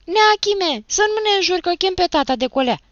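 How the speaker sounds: put-on very high, loud protesting boy; shrill, hurried defiance; defiant boy. A narrator's reading. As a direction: yell back in a high boy's voice. Defiant boy threatens to fetch father.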